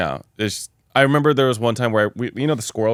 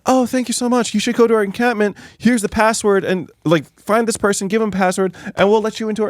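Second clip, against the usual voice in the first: put-on much higher, eager child voice; grateful child; 11 semitones higher than usual. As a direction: thank your rescuer like a relieved kid. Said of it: "the tiefling child will be like".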